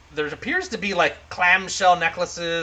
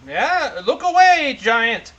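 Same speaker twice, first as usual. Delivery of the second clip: higher, louder dwarf voice; 7 semitones higher, 5 dB louder, much slower than usual. Acted. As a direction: play a dwarf calling out in a lifted pitch. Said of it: "says the dwarf".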